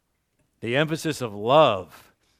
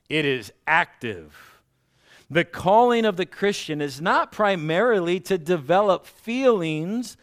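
reading a quote aloud; 6 semitones higher, 5 dB louder than usual. Real, not acted.

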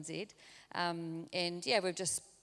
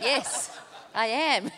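laughing admission; 5 semitones higher, 11 dB louder, much slower than usual. Retold or imitated. Cheekily owning being intense.

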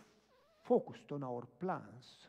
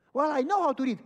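high, loud imitation of the lady; indignant protest; 12 semitones higher, 15 dB louder than usual. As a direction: insist loudly, pitched way up. Woman protesting, retold.

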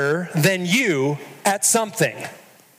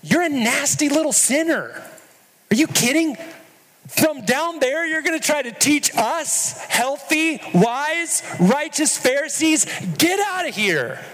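high, scornful Pharisee voice, far up; haughty mocking religious leader; mock sneering Pharisee; 9 semitones higher than usual. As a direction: sneer down at the sinner. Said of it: "you hear what they're saying".

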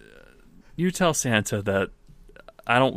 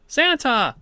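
put-on high, loud child's cry of Santa; excited little boy; 14 semitones higher, 4 dB louder than usual. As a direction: call out like a thrilled small kid. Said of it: "comes up to you and says".

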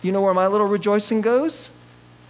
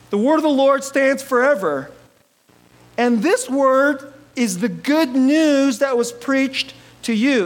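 preaching; 5 semitones higher, 3 dB louder than usual. Acted. Quoting scripture in the sermon.

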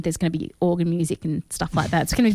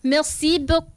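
a hammed-up French "Merci beaucoup", pitched up; mock French; 7 semitones higher than usual. Host thanking co-host in mock French, hammy and light. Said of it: "don't really know how to do a French accent".

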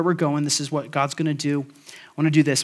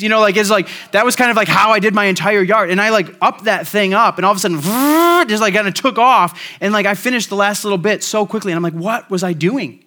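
louder and higher, building energy; animated preacher climax; excited; 6 semitones higher, 7 dB louder than usual. Real, not acted. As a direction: pour out the realization with gusto. Excited payoff of yard illustration.

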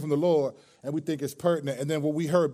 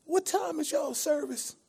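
put-on much higher, slow old-lady falsetto; old church lady voice; 12 semitones higher, 4 dB quieter, slower than usual. Prank caller asking restaurant about church, old lady voice. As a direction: ask sweetly like an elderly churchgoer.

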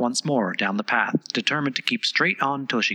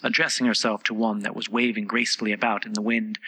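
ordinary delivery

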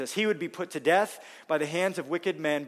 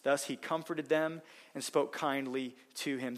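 ordinary talk

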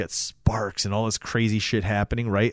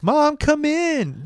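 put-on slow, very high singsong kid; imitated kid's voice; 4 dB louder, much slower than usual. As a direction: mimic a boy luring his mom in. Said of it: "takes his kid's voice".

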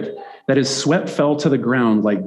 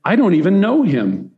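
indignant denial; 6 semitones higher than usual. Acted. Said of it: "he said".

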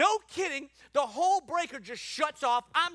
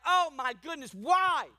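raised, wailing exclamation; acted dismay; 4 semitones higher, 4 dB louder, slower than usual. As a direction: cry out in mock despair, building on the last word. Acts out regret after trouble.

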